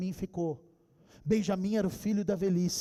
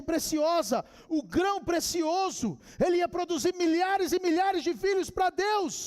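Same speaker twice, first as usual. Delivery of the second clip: high, insistent pulpit delivery; driving, raised preacher's voice; impassioned preaching; 6 semitones higher than usual. Acted. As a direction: proclaim it to the congregation.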